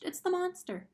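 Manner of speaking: high, loud terrified screech; screeching owl. A narrator's reading. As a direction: shriek the alarm at the crowd.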